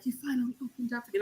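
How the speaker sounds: very soft, quick murmur; hushed retold quote. Spent lover speaking after the whipping, hushed imitation. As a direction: imitate someone spent, barely voicing it.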